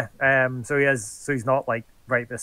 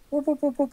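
high whoops; 13 semitones higher, faster than usual. Acted. Skipping away sound.

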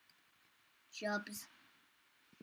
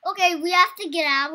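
ordinary delivery

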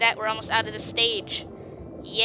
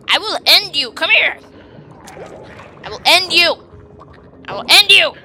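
loud hammed-up threat, getting louder; mock threat; 5 semitones higher, 7 dB louder than usual. Gamer threatening a fleeing cell, mock menace. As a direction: growl it out as a playful menace. Threatening enemy cells in game.

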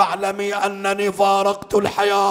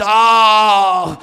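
long, drawn-out moan of grief; anguished lamenting cry; crying out "aah"; 4 dB louder, much slower than usual. A narrator's reading. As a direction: wail a slow, stretched aah. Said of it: "يعني صاحت".

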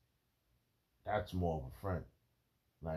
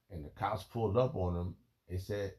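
ordinary talk

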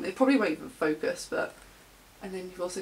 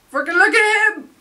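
loud, high fangirl squeal; excited squeal; 11 semitones higher, 12 dB louder than usual. Real, not acted. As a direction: shriek in adoration. Squealing over idol's photo.

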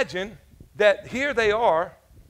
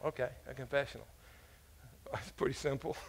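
quiet aside; 6 semitones lower, 15 dB quieter than usual. Shown as a demonstration. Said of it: "What do they call it, huh?".

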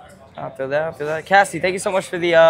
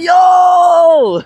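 high, loud "Yo!" of delight; sudden joyous yell at a big gift; excited shout; 14 semitones higher, 8 dB louder than usual. Real, not acted. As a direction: yell it out thrilled. Friend gifted more subs.

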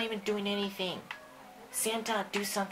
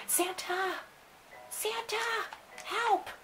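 frightened call; 12 semitones higher, much slower than usual. Acted. Calling Santa for help.

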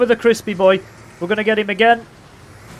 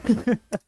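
quick chuckling laugh, higher than speech; short cackle; 4 semitones higher, 4 dB quieter than usual. Real, not acted. Gamer after the battle cry, short cackle. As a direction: let a brief cackle out.

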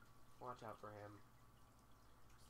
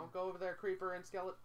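raised, louder, higher warning; urgent warning; 5 semitones higher, 6 dB louder than usual. Real, not acted. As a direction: call out the danger to a teammate. Warning teammate of nearby monsters.

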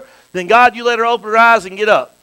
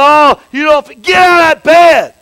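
slow, louder, higher shouted command; 9 semitones higher, 12 dB louder, slower than usual. Acted. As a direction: order the sick out of bed with force. Preacher acting command to sick.

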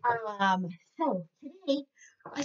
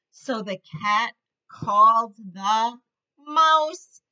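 lively storybook voice for little ones; reading to children; 5 semitones higher, 5 dB louder than usual. A narrator's reading. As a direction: read it like a teacher at story time. Reads picture book to kids.

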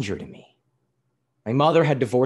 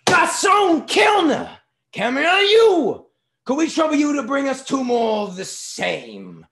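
very high, loud summons to the waiter; shouting again; 8 dB louder than usual. A narrator's reading. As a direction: bellow the order across the room. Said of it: "began shouting".

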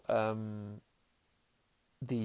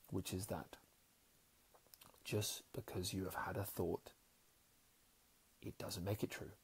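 guided meditation; 4 semitones lower, 11 dB quieter than usual. Acted. Speaker guiding listeners to observe breath, soft meditation voice.

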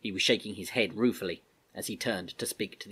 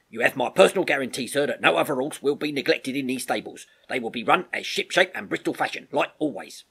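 louder, higher, colloquial sergeant; persuasive army sergeant; 4 semitones higher, 5 dB louder than usual. Acted. Sergeant guaranteeing the Major the stables will run properly, forthright. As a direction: make the case respectfully and forcefully.

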